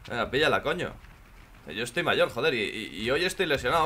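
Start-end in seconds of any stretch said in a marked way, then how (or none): none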